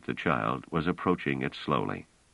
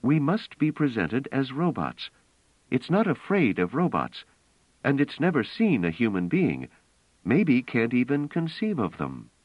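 higher-pitched, insistent story voice; earnest, raised-pitch character dialogue; earnest woman character, urgent; 8 semitones higher than usual. A narrator's reading.

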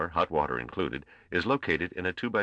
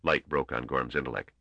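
plain reading voice

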